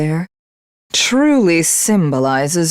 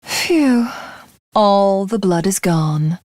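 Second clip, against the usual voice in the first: normal talking voice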